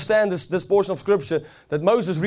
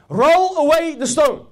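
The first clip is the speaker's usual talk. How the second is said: commanding call; 7 semitones higher, 4 dB louder, slower than usual. Acted. Jesus's command voiced in sermon.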